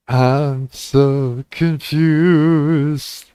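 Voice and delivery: dragged-out bewildered groan; very slow confused. Acted. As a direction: stretch every word in confusion.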